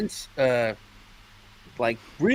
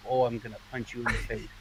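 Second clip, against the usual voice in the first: deadpan mock threat; 7 semitones lower, 11 dB quieter, faster than usual. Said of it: "I'm like".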